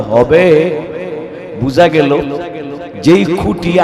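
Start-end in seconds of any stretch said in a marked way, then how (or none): none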